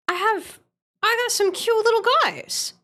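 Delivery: cutesy high voice. Acted. Showing off emotes, cutesy voice.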